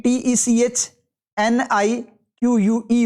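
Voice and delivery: slow, letter by letter; teacher dictating letters slowly; spelling it out. Shown as a demonstration. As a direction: spell each letter clearly for the class. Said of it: "technique की गलत है ना".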